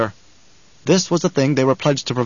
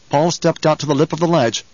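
plain reading voice

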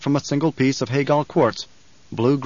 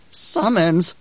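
cackling witch; 6 semitones higher than usual. A narrator's reading. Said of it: "the old witch cackled".

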